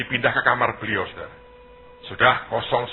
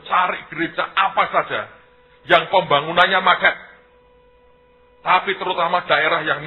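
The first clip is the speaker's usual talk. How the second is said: loud, higher-pitched marching orders; rallying instruction; 6 semitones higher, 5 dB louder than usual. Retold or imitated. Pastor sends friends to find stalled churches, loud rallying instruction. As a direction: send the team out with raised, emphatic voice.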